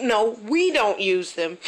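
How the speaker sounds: usual speaking voice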